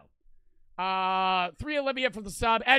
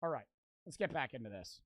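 normal talking voice

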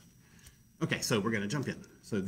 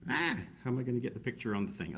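usual speaking voice

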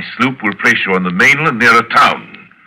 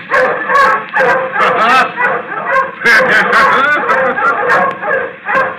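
a villain's long exultant laughter; long gleeful laugh. Acted. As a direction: cackle down at the dogs. Villain laughing at his hounds.

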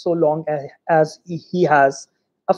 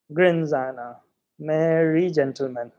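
ordinary delivery, nothing marked

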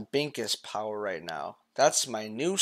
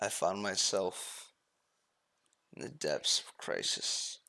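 dramatic monologue; 4 semitones lower, 5 dB louder than usual. Pretend. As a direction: recite it like a solemn confession. Mock-profound monologue.

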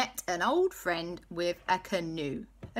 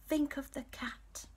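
whisper; 8 semitones higher, 6 dB quieter than usual. Acted. Pleading in whisper.

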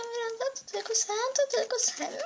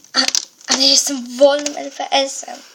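usual speaking voice

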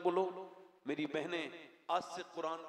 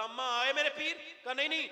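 anxious cry; 10 semitones higher, 6 dB louder than usual. Acted. Voiced son and mother dialogue.

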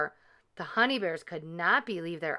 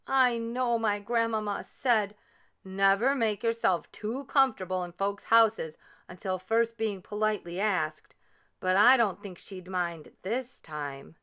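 usual speaking voice

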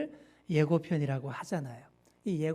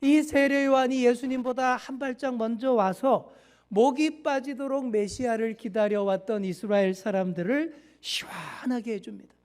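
ordinary delivery

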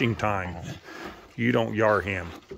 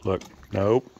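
usual speaking voice

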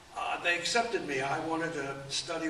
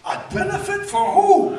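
much higher and much louder imitated voice, laughing; the little man outraged, pitched way up; Misha Elman, indignant; 16 dB louder than usual. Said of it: "dead silence".

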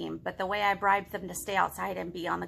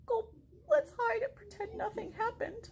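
higher sneaky ostrich; lightly voiced hiding ostrich; a hint of a nervous ostrich; 12 semitones higher, 4 dB quieter than usual. Ostrich character voice.

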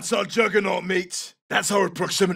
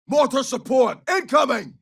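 raised, higher-pitched battlefield warning; urgent combat callout; 7 semitones higher, 3 dB louder than usual. Acted. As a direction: call out incoming fire to the squad.

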